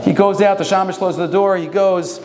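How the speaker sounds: ordinary talk, nothing marked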